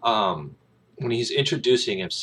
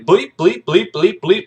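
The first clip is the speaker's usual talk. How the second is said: high, loud staccato bleeps; punchy cartoonish taunting; mocking noises; 11 semitones higher, 11 dB louder, slower than usual. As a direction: pop out each bleep in quick mockery. Mocks laughing cadet with noises.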